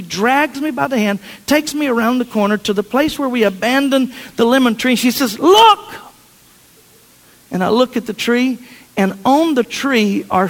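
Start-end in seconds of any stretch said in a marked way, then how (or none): 5.4-5.8 much higher and louder, thrilled; excited reveal. Retold or imitated. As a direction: shout it out like a big surprise. Daughter reveals the tree.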